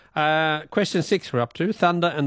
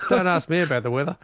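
quick words through real laughter; laughing self-mockery; faster than usual. Real, not acted. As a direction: laugh it off while talking. Host laughs at own mistake.

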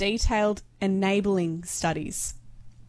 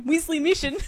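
"Wisley mission" echoed in a mock New Zealand accent, a little higher; mock Kiwi accent; 7 semitones higher than usual. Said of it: "repeat something he's just said back to him".

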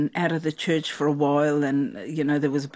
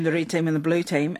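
ordinary talk, nothing marked

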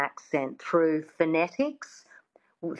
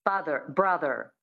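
Father, brother with final R sounded; standard American accent; 3 semitones lower than usual. Shown as a demonstration. Coach models word list, standard American accent. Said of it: "So that's the American".

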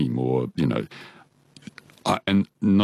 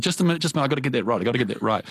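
raised, hurried mock of a flustered teacher; 6 semitones higher, much faster than usual. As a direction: stop him mid-song, a bit fussed. Pianist teacher stopping him.

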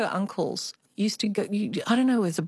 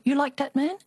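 higher, mock Chinese-accented grandmother; 7 semitones higher than usual. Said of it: "I always have to do the Chinese accent".

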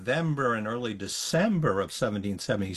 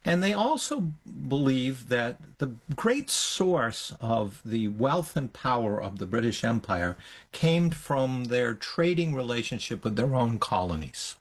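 normal talking voice

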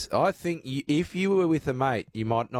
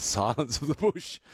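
ordinary talk

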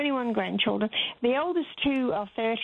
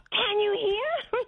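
put-on toddler yelling; 13 semitones higher, slower than usual. Toddler checking if blind Nana can hear, high slow mock yell. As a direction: stretch it out like a child shouting to a deaf grandma. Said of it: "she yelled at me".

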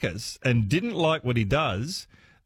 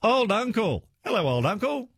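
bright greeting; 9 semitones higher than usual. Real, not acted. Greeting a caller on air.

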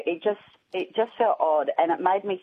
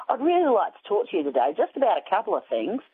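a hint of a chatty Australian accent, a touch higher and quicker; mock Australian telemarketer, lightly voiced; 5 semitones higher, faster than usual. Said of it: "he had this Australian accent".

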